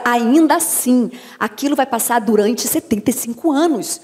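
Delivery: ordinary delivery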